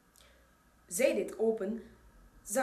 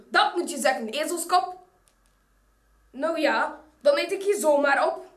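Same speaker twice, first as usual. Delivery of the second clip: louder and fast, snarling threat; furious wolf threatening to eat the girl, louder and fast; angry wolf; 5 semitones higher, 9 dB louder than usual. A narrator's reading. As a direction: spit it at the girl. Wolf snapping back furiously.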